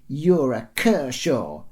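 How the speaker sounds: put-on loud, slow childish insult; little girl's shout. A narrator's reading. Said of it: "shouted the little girl".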